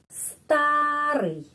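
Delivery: starý with a long stressed A. Shown as a demonstration. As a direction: lean on the A in starý. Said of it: "di sini penekanannya". (tekanan pada huruf A dalam starý)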